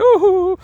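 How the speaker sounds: high, loud mock wail; hammed-up tearful "ooh"; mock crying. Girl moved to tears by a song, mock crying. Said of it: "like, crying. And she, she's just so moved".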